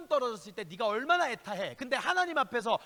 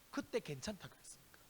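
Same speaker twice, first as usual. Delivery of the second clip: breathy hushed whisper, much softer; 4 semitones lower, 13 dB quieter than usual. Real, not acted. Pastor to congregation, hushing to a whisper. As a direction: drop the voice to a secret whisper.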